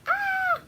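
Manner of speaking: very high, short squeal of fright; mock scream. People spotting the supposed killer, imitated scream. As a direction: imitate a crowd's shriek in falsetto. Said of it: "women are screaming".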